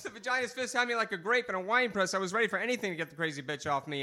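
usual speaking voice

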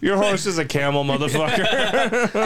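high, slowed mocking jab; laughing tease. Real, not acted. Friend mocks buddy's horse, high slow laughing jab. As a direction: stretch the insult while cracking up.

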